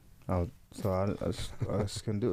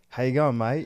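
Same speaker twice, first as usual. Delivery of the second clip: put-on Australian accent; 4 semitones higher, 6 dB louder than usual. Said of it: "try to do an Australian accent".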